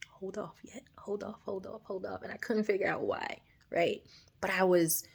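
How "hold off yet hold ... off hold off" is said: soft whisper, barely voiced; God whispering to her to wait, soft whisper; whispered hold off. A gentle voice telling her to hold off, whispered.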